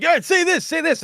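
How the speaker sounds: high, insistent mock demand; pestering demand. People treating the speaker like a jukebox, pestering.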